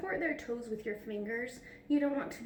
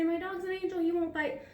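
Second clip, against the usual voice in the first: higher, sing-song imitation of a proud owner; mock dog owner; 6 semitones higher, 3 dB louder than usual. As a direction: mimic a naive owner's protest. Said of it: "I know you're all gonna say".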